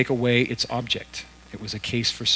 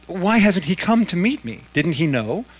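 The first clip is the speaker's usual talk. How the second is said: indignant ghost mother; 6 semitones higher, 6 dB louder than usual. A narrator's reading. Ghost mother demanding her son.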